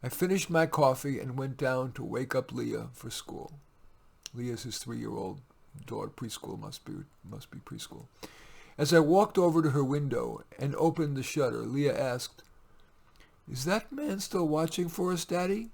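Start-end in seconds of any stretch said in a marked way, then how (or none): 4.3-8.1 dropped to a soft, half-whispered aside; quiet aside. Real, not acted. Reader's muttered aside to himself. 13.5-15.7 a hint of a worried little girl, pitched higher; lightly voiced little girl. Child Leah voiced.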